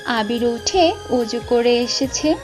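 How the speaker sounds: ordinary delivery, nothing marked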